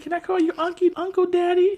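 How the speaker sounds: put-on very high child voice; imitated little girl. Niece asking uncle what to call him, imitated piping voice. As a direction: pipe it up like a small kid asking.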